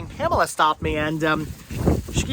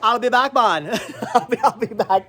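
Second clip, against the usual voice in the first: Jamaicanator voice; 5 semitones higher than usual. Retold or imitated. Running Jamaicanator joke.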